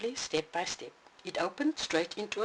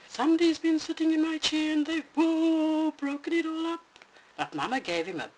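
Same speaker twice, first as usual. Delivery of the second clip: much higher, tearful wail; crying little bear; 12 semitones higher, 5 dB louder than usual. Acted. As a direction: sob it out like a small cub. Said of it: "he cried".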